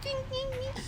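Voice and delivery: tiny, very high, soft ding sounds; imitated dinging. Describing a barely lit chandelier, imitated faint dinging. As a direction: tinkle it high and quietly.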